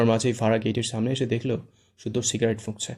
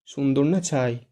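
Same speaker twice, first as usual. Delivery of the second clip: scornful scoff; 3 semitones higher, slower than usual. A narrator's reading. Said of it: "মুখ বাঁকিয়ে বলে".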